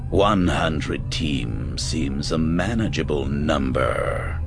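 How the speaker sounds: low, slow, sinister drawl; cold rasping evil emperor. A narrator's reading. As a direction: savor the cruelty calmly. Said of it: "the Emperor said".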